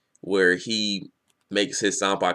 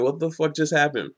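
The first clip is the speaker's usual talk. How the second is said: higher-pitched baffled question; retold disbelief; 6 semitones higher than usual. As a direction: replay your bewilderment, pitched up. Retelling own shocked first reading.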